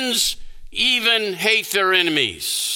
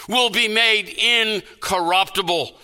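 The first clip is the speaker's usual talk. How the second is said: ordinary talk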